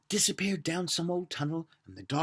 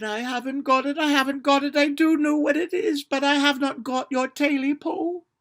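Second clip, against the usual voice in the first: much higher and louder, trembling plea; frightened pleading; 11 semitones higher, 8 dB louder than usual. Acted. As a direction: beg in panic. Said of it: "shivering and shaking".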